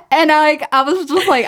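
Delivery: ordinary talk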